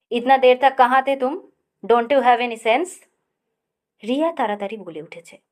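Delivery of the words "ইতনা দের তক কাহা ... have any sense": loud furious roar, slowing at end. A narrator's reading. Father roaring at his late son, furious roar. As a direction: thunder at the late children. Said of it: "আকাশ গর্জে উঠল".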